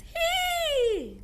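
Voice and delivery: very high squeaky hee; goblin squeal. Shown as a demonstration. Teacher shows the class a goblin's squeal. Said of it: "Goblins dance".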